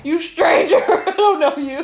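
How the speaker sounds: laughing mock.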